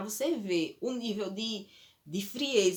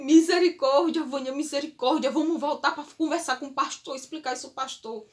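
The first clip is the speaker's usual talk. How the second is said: much higher and louder, a hammed-up panicked plea; husband urging his wife to see the pastor, overdramatic and loud; exaggerated husband; 5 semitones higher, 6 dB louder than usual. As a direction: beg to go back like a dramatic husband. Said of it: "Meu marido é um pouquinho exagerado".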